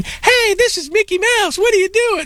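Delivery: very high squeaky cartoon mouse voice; Mickey Mouse voice. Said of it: "you should be an impressionist".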